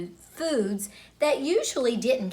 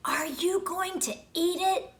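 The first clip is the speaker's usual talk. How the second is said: high whispered question from a classmate; 8 semitones higher than usual. Retold or imitated. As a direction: whisper it across the table so the teacher won't hear. Classmates whisper at lunch table.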